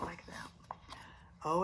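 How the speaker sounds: ordinary delivery, nothing marked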